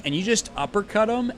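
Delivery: ordinary talk, nothing marked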